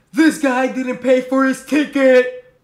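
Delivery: slow, loud, mocking call-out in a put-on voice; loud accusation. A stranger on the bus accusing him: slow loud call-out.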